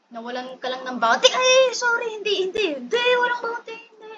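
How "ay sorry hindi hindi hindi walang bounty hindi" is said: high flustered denial. Real, not acted. High-pitched defensive denial.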